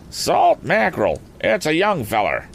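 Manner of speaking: farmer's exclamation. Acted. Farmer seeing the stranger's young face, high surprised exclamation. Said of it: "exclaimed the farmer".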